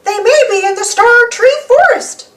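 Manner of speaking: high alien mouse voice; Martian Mickey. Acted. Martian Mickey helping the visitors, high and friendly. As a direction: pitch it way up, friendly and odd. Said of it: "Martian Mickey says".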